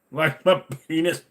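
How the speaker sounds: silly voice. Pretend. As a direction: land the punchline goofy.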